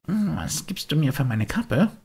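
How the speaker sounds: put-on high, longing puppet boy; a boy pulled toward the music; wistful high boyish voice; Bengele's voice. Bengele offers cap.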